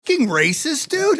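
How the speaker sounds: high indignant mock accusation; a high, hammed-up cry of racism; mock outrage. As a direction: play the offended victim, way over the top. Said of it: "It's like".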